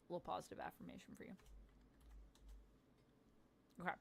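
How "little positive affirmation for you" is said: quick, soft whisper; a fast whispered compliment; whispered affirmation. Acted. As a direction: breathe it out like a secret.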